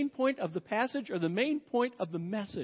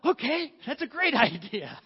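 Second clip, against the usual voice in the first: eager agreement; 3 semitones higher, 4 dB louder than usual. Retold or imitated. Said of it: "And Abraham's like".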